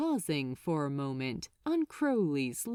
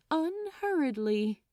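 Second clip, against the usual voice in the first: ordinary delivery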